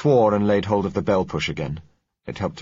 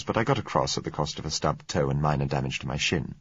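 ordinary delivery, nothing marked

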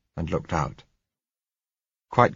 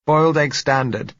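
muffled voice; 9 semitones higher, 6 dB louder than usual. A narrator's reading. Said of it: "said her muffled voice".

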